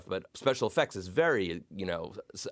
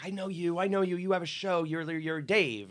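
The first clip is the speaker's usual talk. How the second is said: higher-pitched imitation of an excited woman; excited fan; 9 semitones higher than usual. Woman thinks she knows him from a show, imitated in a high voice. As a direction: mimic her insistent recognition, pitched up.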